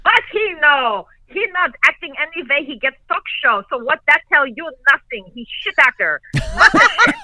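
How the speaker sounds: put-on broken English in heavy European accent, rising to an outraged burst; fuming old count ranting after a bad taping; dad's Dracula-style accent. Imitating dad ranting about Alan Thicke in thick European accent. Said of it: "this is the old Count Dracula's".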